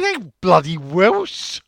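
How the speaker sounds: slow, loud, high mock Welsh; hammed-up Welsh accent, drawn out slowly. Quoting what he said to the bloke, in a mock Welsh accent. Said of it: "I said".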